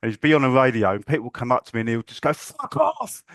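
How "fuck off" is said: shouting. Retold or imitated. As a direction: bark it like a crane boss losing it. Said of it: "Just shout".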